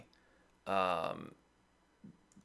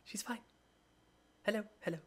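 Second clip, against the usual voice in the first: a soft chorus of creature voices; many tiny voices murmuring; overlapping graveyard whispers; 8 semitones higher, 7 dB quieter, much faster than usual. Acted. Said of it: "you hear a chorus of voices".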